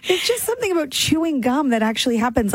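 ordinary delivery

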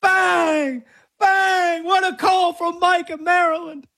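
high shouted announcer call; Mike Breen double bang. Retold or imitated. Radio host imitating the announcer's call for the caller. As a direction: belt it like a play-by-play announcer calling a huge shot. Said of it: "we need Mike Breen to just come out right now and hit a double bang".

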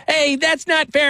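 put-on high, slow whiny kid voice; imitating his son. Young son protesting to his dad, high whiny imitation. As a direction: mimic a little boy protesting.

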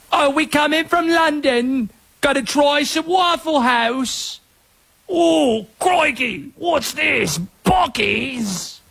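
high, mock London tourist; awful London accent. Host imitates fans visiting from London, in a bad British accent. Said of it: "My accents are awful".

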